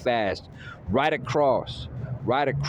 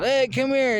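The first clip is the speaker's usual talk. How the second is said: high, louder mocking call; mock taunt; 14 semitones higher, 4 dB louder than usual. Imitating the hurt opponent, he taunts come here in a high mock voice. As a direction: call him over like a cocky taunt. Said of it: "standing there going".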